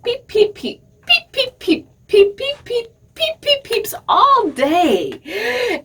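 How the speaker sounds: high, squeaky peeps from a tiny duckling; baby duck peeping. Acted. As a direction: chirp it like a new chick that won't stop.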